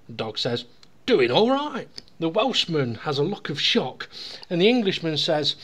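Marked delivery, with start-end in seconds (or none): 1.1-1.9 lightly voiced higher reply; joke's talking dog. Dog answers the Englishman, lightly voiced higher reply.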